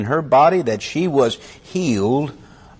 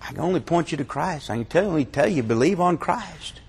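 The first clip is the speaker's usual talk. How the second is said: much softer, gentle quoted reply; hushed pointing to Christ; soft, fast pastor's answer; quiet pastor; 9 dB quieter than usual. Retold or imitated. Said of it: "all the pastor could say".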